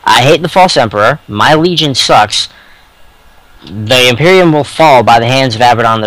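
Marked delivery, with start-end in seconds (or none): none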